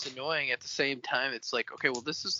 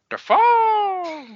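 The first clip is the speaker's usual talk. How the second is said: much higher, louder crazed yell of the name; shouted name; 7 dB louder than usual. Pretend. Cohost joking about Willem Dafoe with a much higher, louder crazed yell. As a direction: belt the name out like a manic villain.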